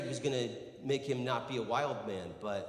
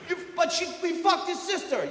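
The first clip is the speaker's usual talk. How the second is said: very high, loud prompt; stumbling high-pitched model shout; yell; 9 dB louder than usual. Shown as a demonstration. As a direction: holler the line for someone to practise. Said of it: "Yell it".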